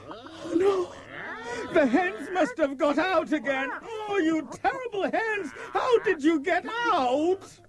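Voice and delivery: high exasperated cry at the hens; dismayed scolding. Acted. Farmer discovers ruined garden.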